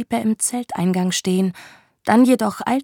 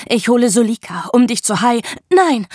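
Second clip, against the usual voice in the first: building to a loud, higher cry; panicked shout; 3 semitones higher, 7 dB louder than usual. Acted. Woman stopping the man fetching Sulika, shouting. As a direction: cut him off in alarm.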